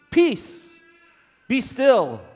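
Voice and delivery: loud, high commanding shout; rebuking command. A narrator's reading. Jesus commanding the wind and sea, forceful rebuke. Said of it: "rebuked the wind and said to the sea".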